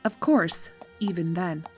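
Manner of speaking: ordinary delivery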